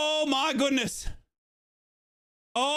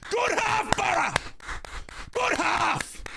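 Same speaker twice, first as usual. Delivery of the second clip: excited cheer; 8 semitones higher, slower than usual. Real, not acted. Fan cheering at halftime.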